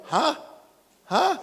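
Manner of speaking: questioning, rising "Hah?"; baffled huh. Acted. Locals answering a learner of Mandarin, baffled. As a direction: act out not understanding a word.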